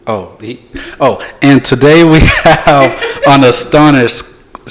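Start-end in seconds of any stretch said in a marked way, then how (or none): none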